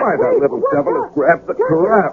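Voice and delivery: startled exclamation. Acted. Monkey grabs his wine.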